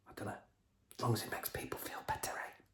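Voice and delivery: fast whispered aside; sarcastic whisper. Real, not acted. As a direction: whisper the jab quickly.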